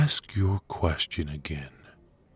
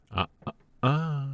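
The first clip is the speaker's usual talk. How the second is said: high, teasing "ah, ah, ah"; tutting reprimand, pitched up; chiding; 10 semitones higher than usual. Acted. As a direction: scold playfully, lifting the pitch high. Chiding opponent for breaking the rules.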